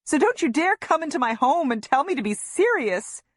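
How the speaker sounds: high-pitched, indignant tirade; furious mother telling someone off; angry shout. A narrator's reading. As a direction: keep shouting, high and forceful. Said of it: "I shout".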